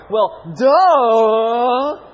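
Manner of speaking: put-on loud warbling kid's "Well, duh". Shown as a demonstration. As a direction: bray it like a smart-aleck kid. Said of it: "They kinda have a warble to their voice".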